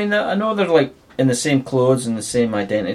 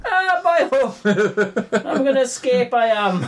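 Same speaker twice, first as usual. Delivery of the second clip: silly high voice; 12 semitones higher than usual. Pretend. Silly put-on escape voice.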